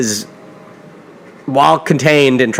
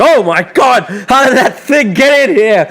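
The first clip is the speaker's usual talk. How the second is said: high mock panicked shriek, slower; freaking out; 9 semitones higher, slower than usual. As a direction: imitate a man spooked by a cat. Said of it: "he freaks out".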